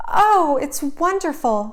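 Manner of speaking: overjoyed at the dress; delighted exclamation. A narrator's reading. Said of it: "Cinderella exclaimed".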